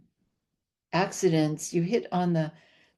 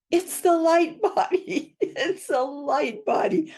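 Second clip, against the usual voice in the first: very high, slow, laughing exclamation; astonished eureka; 12 semitones higher, 3 dB louder, slower than usual. Retold or imitated. Author reliving her three a.m. revelation, high and laughing. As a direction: gasp it out, delighted and stunned. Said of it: "I went".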